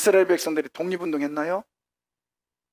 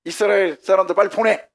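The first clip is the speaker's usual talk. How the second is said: loud, higher-pitched order; God's commanding voice; 4 semitones higher, 6 dB louder than usual. Acted. Preacher as God ordering Cyrus to release Israel, loud command. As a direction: order it with urgent authority.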